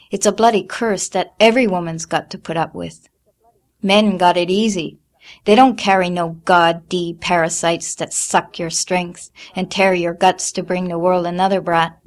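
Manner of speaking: loud bitter tirade; bitter rant. A narrator's reading. Mother ranting to daughter about periods, bitter. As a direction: spit out the grievance.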